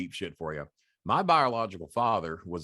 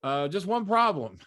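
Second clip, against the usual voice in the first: lightly voiced Southern general, higher and louder; a hint of Southern general; 9 semitones higher, 6 dB louder than usual. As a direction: imitate a Southern general raising an objection. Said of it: "he talked like a Southern general".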